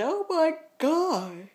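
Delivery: high, loud, startled cry; startled shout. Real, not acted. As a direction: yell out in sudden shock.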